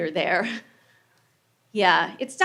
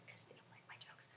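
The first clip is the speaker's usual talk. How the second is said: whispered aside; 34 dB quieter than usual. Real, not acted. Aside muttered to audience.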